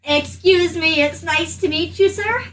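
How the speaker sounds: loud, polite call to someone inside. Acted. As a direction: call out so he hears.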